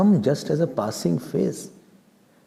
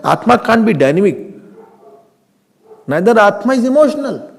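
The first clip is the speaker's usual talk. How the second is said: ordinary talk, nothing marked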